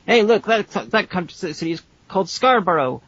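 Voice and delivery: Scarborough said SCAR-burr-oh by an imitated American; mock American tourist. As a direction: play an American visitor reading out Scarborough with "oh". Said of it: "an American comes over here".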